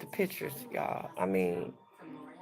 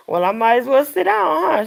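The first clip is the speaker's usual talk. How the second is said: amused retort; 7 semitones higher, 12 dB louder than usual. Retold or imitated. Laughingly retells joke with mother.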